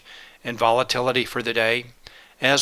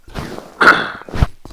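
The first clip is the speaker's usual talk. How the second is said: loud rough throat clearing; a real throat clear from a cold; 7 dB louder than usual. Real, not acted. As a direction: clear a sore throat hard. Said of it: "please excuse my voice".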